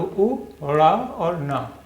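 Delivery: उ, ड़ा और ना drawn out, each syllable held; slow syllable by syllable. Shown as a demonstration. Teacher spells out a word for pupils, very slowly. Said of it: "अब आप इसे पढ़िए".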